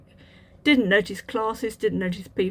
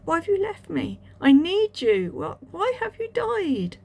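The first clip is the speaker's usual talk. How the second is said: anguished plea; 10 semitones higher, 5 dB louder than usual. Retold or imitated. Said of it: "I was like".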